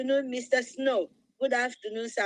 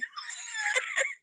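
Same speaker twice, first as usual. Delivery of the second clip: shrieking laugh; 5 dB quieter than usual. Real, not acted. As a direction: let out a sudden squeal of a laugh. Bursts out laughing, delighted.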